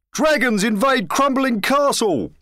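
high, loud town crier's cry; shouted headline. A narrator's reading. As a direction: shout the headline across a square. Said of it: "shouted the news crier".

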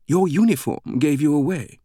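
high, teasing British lilt; playful British woman. A narrator's reading. British greeter pointing out Langdon's clothing, playful and high. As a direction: tease them playfully in a high voice. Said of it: "She playfully motioned to his clothing".

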